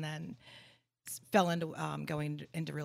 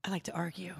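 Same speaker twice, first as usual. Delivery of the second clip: whispered; faster than usual. Real, not acted. Cheeky confession.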